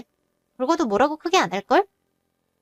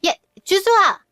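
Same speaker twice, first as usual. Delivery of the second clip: 높고 크게 딸의 이름을 호통치듯; 이름을 부르며 버럭 소리쳐라; angry yell; 8 semitones higher, 5 dB louder than usual. Acted. Mother yells at her daughter. (화난 엄마가 딸 이름을 크게 외침)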